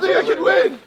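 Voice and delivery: ordinary delivery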